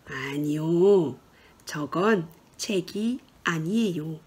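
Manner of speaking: brisk, clear model reading of Korean lines; reading a dialogue aloud. Shown as a demonstration. Teacher reading the lesson two dialogue to students, clear model reading. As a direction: read the lesson dialogue like a textbook recording.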